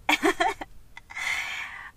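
real burst of laughter; amused laugh at herself; self-mocking laugh. Real, not acted. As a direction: laugh at your own naive hope. Laughs at her own hope.

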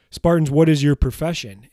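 loud rallying call to the troops; commander's call to his men. Retold or imitated. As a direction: shout it out like a general addressing soldiers. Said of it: "he turns around to his men and he says".

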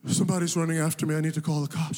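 fast, panicked rush of words; panicked plea. Retold or imitated. Man at a stranger's door begs for help, fast and panicked. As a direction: blurt it out, out of breath.

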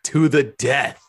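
hammed-up fighting words; mock battle cry. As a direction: declare a duel in jest. Vowing a decisive round.

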